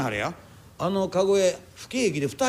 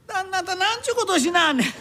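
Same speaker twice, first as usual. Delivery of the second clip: aghast protest; 9 semitones higher than usual. Acted. Bearer protests broken palanquin.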